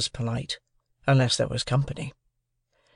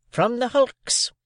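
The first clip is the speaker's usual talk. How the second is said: high, slow, curt answer; sister; 10 semitones higher, much slower than usual. A narrator's reading. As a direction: drop it curtly on the boy. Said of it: "exclaimed my sister".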